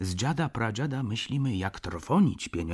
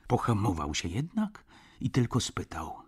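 ordinary delivery, nothing marked

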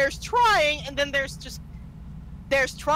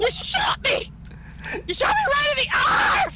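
high, loud, laughing wail; pained cry; 4 dB louder than usual. Acted. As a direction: wail in hammed-up pain.

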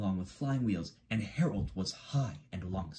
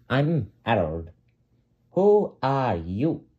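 slow helicopter voice; 2 semitones higher, 7 dB louder, slower than usual. Acted. Helicopter introduces himself.

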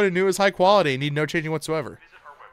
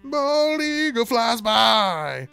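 hammed-up flag-waving hero, slow and higher; mock patriotic American; 5 semitones higher, 5 dB louder, slower than usual. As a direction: boom it out like a cheesy action-movie patriot. Patriotic American parody after accents talk.

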